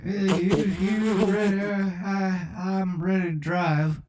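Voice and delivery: comic presidential impersonation; Joe Biden. Acted. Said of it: "I'm just Joe Biden on the track".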